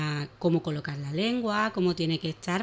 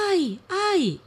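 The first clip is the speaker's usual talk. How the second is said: mock cries of pain; 11 semitones higher, 3 dB louder than usual. Trapped animals complaining.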